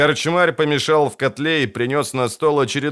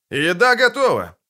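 calling out; 10 semitones higher than usual. A narrator's reading. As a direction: call it across the room. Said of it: "крикнул корчмарь".

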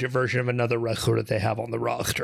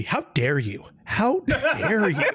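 rising to a high, slow, laughing "how dare you"; mock-indignant cry, climbing up; hammed-up offended gasp; imitating outrage; 7 semitones higher, slower than usual. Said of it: "I was just waiting for Scott Chris Paul to be".

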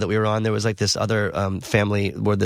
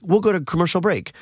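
higher-pitched TV host throwing to a break; Jerry Springer; 8 semitones higher than usual. Retold or imitated. Host turning aside to announce the break, lightly imitated. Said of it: "Jerry goes to, like, say something".

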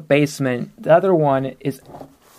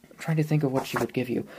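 ordinary talk, nothing marked

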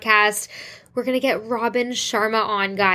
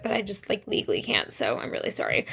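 whispered apology; 8 dB quieter, faster than usual. Real, not acted. Host apologizing to listeners for a secret project, hushed. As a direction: confide it like a secret you can't share.

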